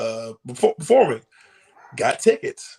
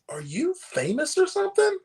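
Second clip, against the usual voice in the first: mock curious friend; 14 semitones higher than usual. Friend asks, voiced by teller.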